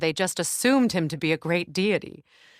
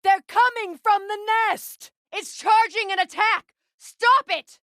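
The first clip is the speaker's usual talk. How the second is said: high, loud alarm calls; battle warnings shouted; 4 dB louder than usual. Acted. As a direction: warn your ally over the noise of the fight. Combat warnings during fight.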